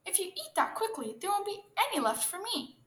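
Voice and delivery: high-pitched, worried complaint; pleading protest. A narrator's reading. Turtle to hare eating all the cabbages, protesting.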